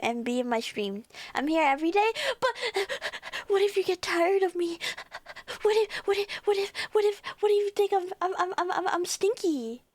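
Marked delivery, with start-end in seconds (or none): 2.4-9.8 high, stammering fake-tearful worry; playful panicky whine, pitched high; mock crying. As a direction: whimper and stutter as if about to cry. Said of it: "I'm gonna have to start crying".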